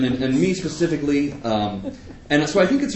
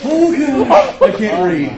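high squeal through laughter; mortified laugh, much louder and higher; laughing embarrassment; 13 semitones higher, 9 dB louder, much slower than usual. Real, not acted. Reader realizes he said the cursed word, laughing. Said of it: "you said that out loud".

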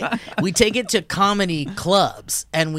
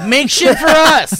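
high, loud, slow demand; hammed-up bossy order; 9 semitones higher, 9 dB louder, slower than usual. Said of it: "And then we're just like".

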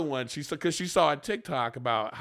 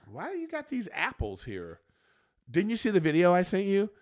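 tag-along nagging imitation; 7 semitones higher, 3 dB louder than usual. Acted exchange about sent videos.

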